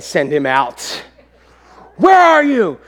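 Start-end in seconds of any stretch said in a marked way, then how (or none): 2.0-2.8 high, loud summons; angry calling out. Retold or imitated. Livid dad summoning his firstborn, angry calling out. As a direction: act out hollering for your child.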